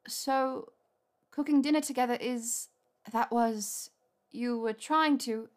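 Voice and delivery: high, halting and puzzled; flustered realisation. A narrator's reading. Hermione realises dinners were courtship, flustered and halting. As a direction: piece it together in broken phrases.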